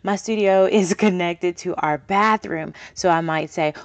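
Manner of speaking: ordinary delivery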